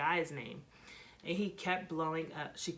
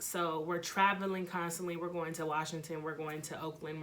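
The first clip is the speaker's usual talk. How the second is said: ordinary delivery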